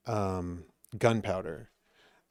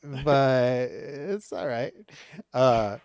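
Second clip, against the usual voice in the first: ordinary talk, nothing marked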